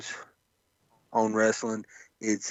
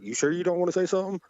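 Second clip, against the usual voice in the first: higher, fast, sing-song teasing; teasing mock question; 7 semitones higher, much faster than usual. Imitating Kevin Owens taunting Leon, high and fast. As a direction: needle him with a quick mock offer. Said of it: "He's like".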